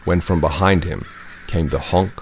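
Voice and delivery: usual speaking voice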